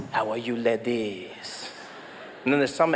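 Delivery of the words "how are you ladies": low, soft French seducer; French accent. Acted. Comic flirts with the ladies, soft French accent. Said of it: "You speak with a French accent".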